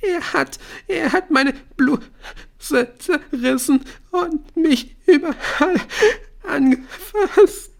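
high-pitched, loud crying outburst; distraught girl, slow broken wailing; choppy sobbing. A narrator's reading. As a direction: force the syllables out brokenly through sobs. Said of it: "Die Silben kamen nur noch abgehackt aus Aishas Mund".

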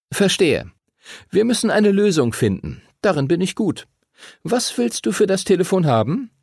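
leicht gespielter Junge, höhere Stimme; beschwichtigender Arthur. A narrator's reading. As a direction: mit angehobener Stimme vermitteln. (beschwichtigender Junge auf Lösungssuche, leicht gespielt)

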